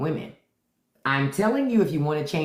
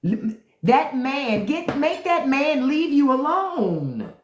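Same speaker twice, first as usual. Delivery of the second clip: mock prayer; 7 semitones higher, slower than usual. Pleading with God, mock.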